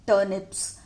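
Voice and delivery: ordinary talk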